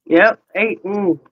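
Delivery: usual speaking voice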